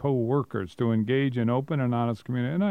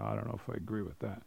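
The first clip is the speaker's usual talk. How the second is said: quick, much softer muttered aside; 3 semitones lower, 13 dB quieter, much faster than usual. Real, not acted. Host doubting the reading, muttering a fast soft aside. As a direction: toss it off under your breath, fast.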